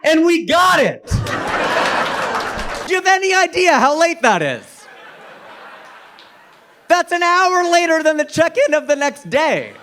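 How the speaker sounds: loud, stretched-out comic disbelief; triumphant shouting. Acted. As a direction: crow over an outrageous win. Crowing over the late checkout won.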